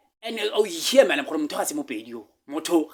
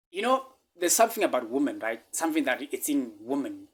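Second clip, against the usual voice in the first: normal talking voice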